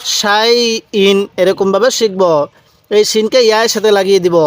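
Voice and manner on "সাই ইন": সাই ইন read very slowly, both syllables drawn out; slow drawn-out recitation. Shown as a demonstration. Teacher reciting সাই ইন to students, slowly drawn out. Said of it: "কীভাবে পড়ব".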